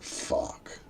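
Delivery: whispered curse, retold; hushed, voiceless swearing; stunned whisper. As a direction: let it out under the breath as bad news sinks in. His reaction to grim news, retold.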